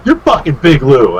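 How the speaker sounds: acted starstruck exclamation; imitated excited recognition. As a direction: blurt it like a fan who just caught on. Shop guy recognizes him, retold.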